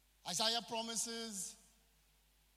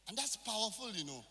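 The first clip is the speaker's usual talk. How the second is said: usual speaking voice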